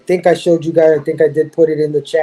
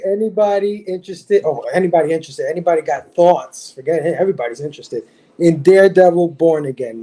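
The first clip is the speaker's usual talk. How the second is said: usual speaking voice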